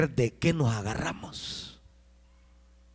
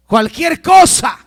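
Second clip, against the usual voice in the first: sudden loud, high shout; grito de predicador; 13 semitones higher, 14 dB louder, slower than usual. Real, not acted. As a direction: burst out loud and high, drawn out. Preacher erupts over what people use. (grito de predicador, fuerte y agudo)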